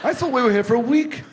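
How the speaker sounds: high, loud, comic protest; mock indignation. Guest teasing host who hurries him, mock-indignant. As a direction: protest with playful disbelief, pitch shooting up.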